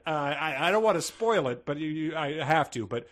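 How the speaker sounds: ordinary delivery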